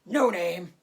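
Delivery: booming, jovial pig; a hint of a big hearty uncle. A narrator's reading. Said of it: "he said in a big, hearty voice".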